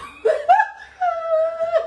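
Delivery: high, loud burst of laughter; a squealing laugh breaking out; shrieking laugh. Real, not acted. Story gets funnier, group laughs. (éclat de rire aigu et fort)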